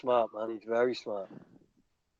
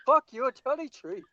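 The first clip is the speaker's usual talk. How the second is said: mock Irish accent; 7 semitones higher than usual.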